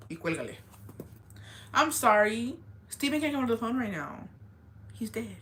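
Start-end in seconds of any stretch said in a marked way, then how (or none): 1.7-5.4 higher, fake polite phone voice; mock phone answer. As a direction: answer the phone sweetly then deadpan.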